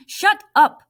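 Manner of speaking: loud high furious shout; angry shout. Acted. Omar cutting off Jerry with a furious shout. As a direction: yell at him to stop.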